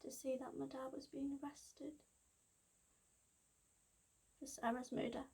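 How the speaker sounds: very soft, near-whispered confession; subdued shock. Acted. Daughter recalling her dad's arrest, hushed and stunned. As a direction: sink almost to a whisper. Recounts father's arrest for murder.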